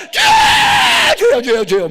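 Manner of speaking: long, very high cry to the Lord; 통성기도 shout. Acted. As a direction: scream it out like a fervent prayer meeting. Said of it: "막 외치니까". (목청껏 길게 부르짖는 외침)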